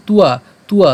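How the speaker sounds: তুয়া said "tu-a", with a u glide. Shown as a demonstration. Teacher explains the written b, তুয়া said tu-a. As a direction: turn the b into u in তুয়া. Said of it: "উয়োর মতো হবে".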